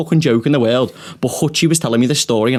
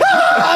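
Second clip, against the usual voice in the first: very high-pitched laughing scream; retold scream; 4 dB louder than usual. Comic re-enacting screaming at his mate's failure, gleeful shriek. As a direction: let out a re-enacted shriek of glee. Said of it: "I start screaming".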